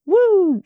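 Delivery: high "woo" like a police siren; quick, high-pitched siren whoop; siren imitation. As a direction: imitate a cop car's whoop.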